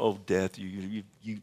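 ordinary talk, nothing marked

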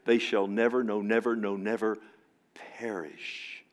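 repeated never pressed hard, perish dropped to a whisper; emphatic never. Acted. Preacher stressing the Greek emphasis to the church, whispering perish at the end. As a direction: hammer each never, then whisper perish. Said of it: "it's emphatic".

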